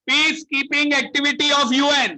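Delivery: loud, high chant from the belly; shouting. Shown as a demonstration. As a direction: project it from the gut, full voice. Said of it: "मेरे साथ सब लोग जोर जोर से बोलो".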